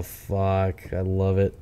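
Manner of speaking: normal talking voice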